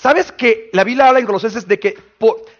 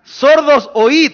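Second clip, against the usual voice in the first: loud proclamation; 6 semitones higher, 5 dB louder, much slower than usual. Shown as a demonstration.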